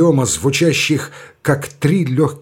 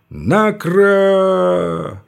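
higher, very slow, ringing; sharp metallic command; 7 semitones higher than usual. Acted. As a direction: cut the air like a parade colonel. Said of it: "резким металлическим голосом".

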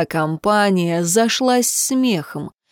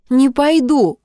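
higher, defiant retort; stubborn refusal; 6 semitones higher, 4 dB louder, slower than usual. A narrator's reading. Girl refusing to be sent home, stubborn. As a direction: dig in stubbornly. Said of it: "упрямо бросила Джемма".

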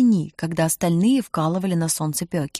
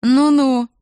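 louder, higher mocking murmur of doubt; sniggering scepticism; 4 semitones higher, 6 dB louder than usual. A narrator's reading. Kidnapper scoffs at the mother's excuse, mocking. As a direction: draw it out, openly disbelieving. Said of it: "голос хихикнул".

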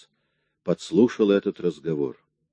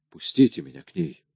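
higher, urgent begging; desperate plea; 6 semitones higher than usual. Acted. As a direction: beg them to let you go to her. Solo begs to go to daughter.

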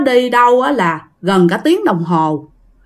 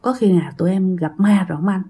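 hushed, lower, hurried frightened stammer; scared blurt; 4 semitones lower, 5 dB quieter, faster than usual. Retold or imitated. Soldier asks his leader if they met a ghost, hushed and scared. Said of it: "một thằng nó mới buột miệng".

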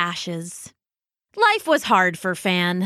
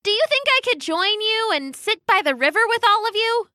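high, fast and eager; eager cheery bird; 11 semitones higher, faster than usual. Acted. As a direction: ask brightly, hopeful. Fan eager, hopeful.

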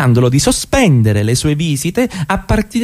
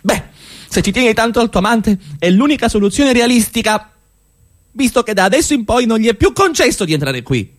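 high, strained voice of barely held rage; husband losing his composure with his unfaithful wife, furious; rising fury; 9 semitones higher than usual. Acted. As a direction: push the pitch up as the anger takes over.

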